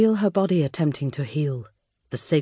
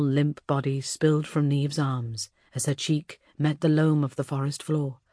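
ordinary delivery, nothing marked

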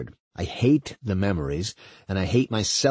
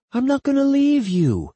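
high, louder, insistent reassurance; reassuring woman; 5 dB louder than usual. Acted. Woman promises to stay with scared partner, insistent. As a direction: promise it firmly in a high-pitched voice.

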